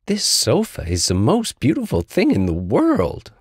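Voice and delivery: put-on high, childlike storybook tiger; high-pitched, happily impressed; delighted Little Tiger.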